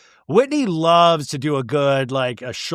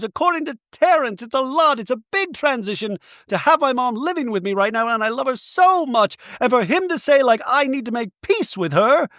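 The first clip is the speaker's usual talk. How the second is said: much higher, fragile put-on voice; Bronwyn voice; 10 semitones higher than usual. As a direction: play her as close to tears. Bronwyn's emotional explanation.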